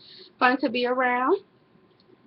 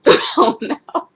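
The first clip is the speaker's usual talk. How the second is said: laughing it off; 7 semitones higher, 9 dB louder than usual. Real, not acted. Laughing after joke about offense.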